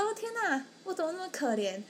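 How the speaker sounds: high, mock-pitiful wail of self-pity. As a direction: feel sorry for yourself, lightly hammed-up. 病床上自怜的心声. (高音、半开玩笑地自怜)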